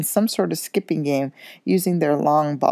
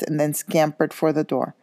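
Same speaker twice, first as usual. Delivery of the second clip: usual speaking voice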